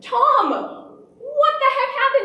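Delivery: high, slow, stretched-out demand; hammed-up shrieking scolding of a husband; mock outraged mother. Mom confronting dad over the injury, mock outrage.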